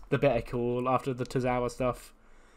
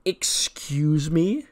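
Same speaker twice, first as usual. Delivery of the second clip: offended diva; 5 semitones higher, 6 dB louder, much slower than usual. Retold or imitated. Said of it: "just turns around and goes".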